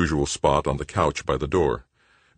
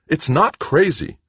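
insistent; 14 semitones higher, 6 dB louder than usual. Acted. Brothers arguing over the plan.

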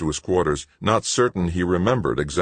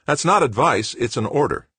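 firm, a raised command; 10 semitones higher, 5 dB louder than usual. A narrator's reading. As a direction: lay down the order. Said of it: "Anson said firmly".

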